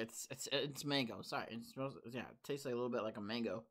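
muffled behind drink can; speaking into can opening; talking with can at lips. Real, not acted. Seen 0: Can pressed to mouth and nose while talking, likely sniffing it.

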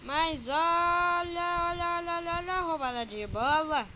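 loud, building call of the steal; excited commentary. Real, not acted. Live commentary, ball stolen. (narração empolgada e crescente)

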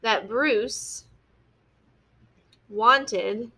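very slow, word by word with long pauses; slow dictation. Shown as a demonstration. Teacher dictating the sentence to the class while writing, slow and word by word. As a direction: spell it out slowly as you write it on the board. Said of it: "So we're going to say".

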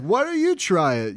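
high, loud mock heckle; crowd challenge. Crowd dares Neptune to taste it, loud challenge. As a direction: call out like a goading crowd member. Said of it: "they're like".

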